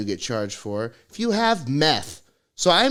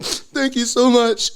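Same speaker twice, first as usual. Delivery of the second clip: overdone fake thanks; 11 semitones higher, 6 dB louder, slower than usual. Faking gratitude to the cop.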